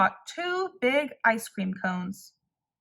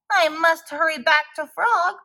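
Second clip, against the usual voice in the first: higher and louder, in a hurry; cried out; 6 semitones higher, 5 dB louder than usual. A narrator's reading. Toad hurrying to Frog with melting ice cream, crying out. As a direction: Toad rushing back, urgent. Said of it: "he cried".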